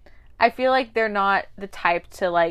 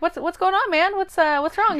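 higher, worried voice of the date; concerned date voice; 11 semitones higher, 3 dB louder than usual. Retold or imitated. Date asking a flustered guy what's wrong, higher and concerned. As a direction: play the confused date, pitched up.

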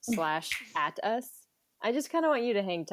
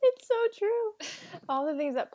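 laughing; 3 dB louder than usual. Real, not acted. Laughing high agreement.

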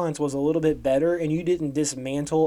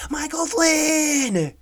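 much higher, louder, stretched-out shout; dramatic reveal shout; 12 semitones higher, 5 dB louder, much slower than usual. Real, not acted. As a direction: yell the name out slowly like a big reveal.

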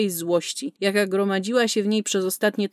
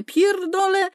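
higher, angry final curse; angry repeat; 11 semitones higher, slower than usual. Acted. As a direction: hurl the last curse even higher. Furious outburst about cartels. (jeszcze wyżej, wściekle rzucone przekleństwo)

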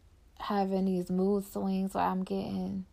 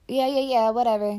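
higher, louder, breezy brush-off; dismissive shrug; 3 semitones higher, 8 dB louder than usual. Real, not acted. Laughing off her hopes for love, a loud breezy brush-off. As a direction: wave it all away.

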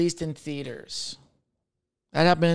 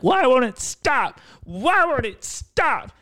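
high, slow, panicky begging; high mock-desperate plea; desperate pleading; 9 semitones higher, 3 dB louder, much slower than usual. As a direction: plead with the ball to stop. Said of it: "you're like".

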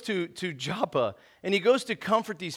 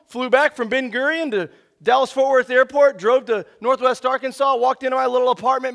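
excited; 5 semitones higher, 6 dB louder than usual. Real, not acted. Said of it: "I was so excited".

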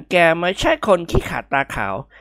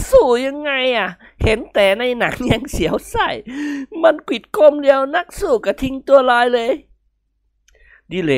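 a lightly voiced whiny near-cry, higher; mock whimpering; 8 semitones higher than usual. Kimnguan whines to his friends about the bull, mock tearful. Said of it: "กิมหนวนร้องไห้ ทำปากแบะน้ำตาคลอ".

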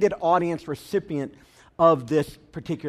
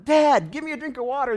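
put-on higher child voice pleading again; a child nagging his dad, pitched up; whiny kid; 9 semitones higher, 4 dB louder than usual. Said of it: "So one more time".

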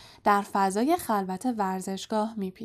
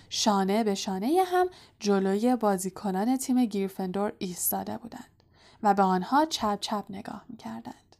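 ordinary delivery

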